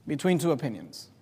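suddenly low and soft; quiet, low, weighty question; hushed challenge. Acted. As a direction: drop right down for a grave finish. Challenge drops to hushed close.

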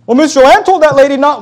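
high, loud mock whine of an excuse; whiny excuse. Pastor mocking a member's excuse, high mock whine. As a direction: mimic a member blaming someone else. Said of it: "mocking a church member's excuse".

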